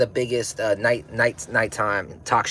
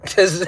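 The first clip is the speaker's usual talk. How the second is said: loud laugh; 14 semitones higher, 10 dB louder than usual. Real, not acted.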